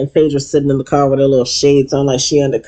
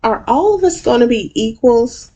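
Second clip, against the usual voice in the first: pointed question; 7 semitones higher, slower than usual. Retold or imitated. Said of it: "Simone's like".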